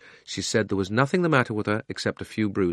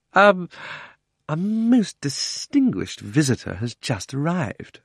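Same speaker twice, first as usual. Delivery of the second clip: high, slow, hesitant self-importance; pompous uncle; 7 semitones higher, slower than usual. Acted. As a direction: announce the guest grandly, high and drawn out. Uncle pompously announces a visitor.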